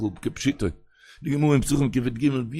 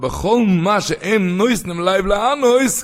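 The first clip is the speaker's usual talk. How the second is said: raised, drawn-out sing-song; impassioned quoting; 7 semitones higher, 5 dB louder, slower than usual. Acted. Rabbi quoting Rashi to his listeners, impassioned chanting. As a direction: chant the quotation with fervour.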